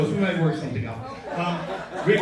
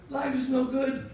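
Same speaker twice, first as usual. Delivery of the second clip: high-pitched, plaintive lament; mock despair; 8 semitones higher than usual. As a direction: plead hopelessly in a raised voice. Rick's gloomy quote, voiced high.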